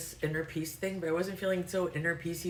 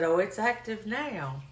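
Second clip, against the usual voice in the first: imitated Arkansas drawl, higher and slow; heavy Arkansas accent; 5 semitones higher, slower than usual. Agent retelling an insurance caller's answer, heavy Arkansas accent. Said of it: "just really heavy accent for me".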